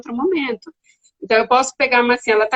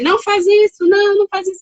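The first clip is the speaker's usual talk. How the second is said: raised, high-pitched mock reprimand; parent's scolding; 10 semitones higher, 5 dB louder than usual. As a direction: urgently tell off a child, acted. Acting out parent scolding misbehaving child.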